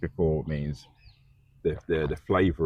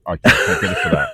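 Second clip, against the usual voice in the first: laughing; 10 dB louder than usual. Real, not acted. Laughing refusal.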